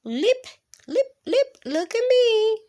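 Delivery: high, bouncy rabbit voice; high, springy little bunny voice. Pretend. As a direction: hop the words up high.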